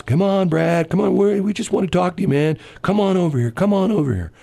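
high, even, drawn-along coaxing as imitated cops. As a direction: call him over, imitating police negotiators. Voicing cops coaxing the man.

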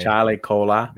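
copying a bad Boston accent. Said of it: "that was a terrible Boston accent".

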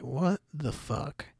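slow, soft, stunned disbelief; stunned what the. Retold or imitated. Narrator voicing Allison's shocked face, slow and hushed. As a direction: drag it out like you can't believe your eyes.

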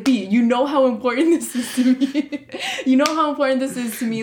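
mock pleading through laughter; imitating him begging, breaking into laughs; laughing mock plea; laughing imitation. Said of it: "The drama".

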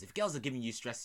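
usual speaking voice

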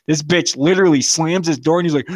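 ordinary talk

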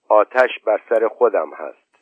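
ordinary delivery

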